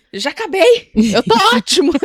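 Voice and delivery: high, far-from-own little voice; panicky child. Retold or imitated. Imitating child spotting the slipper coming out, high and comically eager. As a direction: imitate a kid hastily backing down, comically high.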